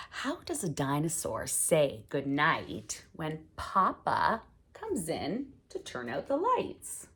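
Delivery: put-on sing-song read-aloud for little kids; gentle storytime voice. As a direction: read it like a bedtime book. Reading picture book to kids.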